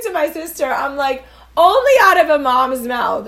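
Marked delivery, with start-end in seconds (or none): 1.6-3.3 loud, high burst of amusement; real delighted exclamation; excited laughing outburst. Real, not acted. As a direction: blurt it out, still cracking up. Laughing about mom to sister.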